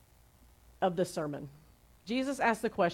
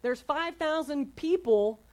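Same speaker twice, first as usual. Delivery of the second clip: mock whine, pitched up and swooping; whining disciples; 6 semitones higher than usual. Disciples whine to Jesus about the huge crowd, mock whining. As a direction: complain in an exaggerated, higher-pitched whine. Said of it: "the disciples start whining".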